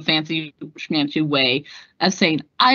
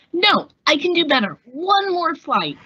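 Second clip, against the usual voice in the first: higher, pleading eagerness; eager plea; 9 semitones higher than usual. Acted. Young pilot begging instructor for another flight, eager. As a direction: beg for one more go.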